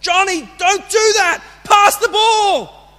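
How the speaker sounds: loud, high shout across the field; coach yelling. Retold or imitated. Coach on the sidelines yelling at little players, loud shout. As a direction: bellow it like a soccer coach at the kids. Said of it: "they'll see the coach yelling out".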